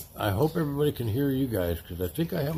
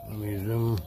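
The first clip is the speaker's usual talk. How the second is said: normal talking voice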